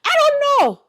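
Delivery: very high whiny I don't know; high-pitched helpless shrug; exasperated. Acted. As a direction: throw up your hands, squeaky and helpless. Answering imagined viewers, exasperated.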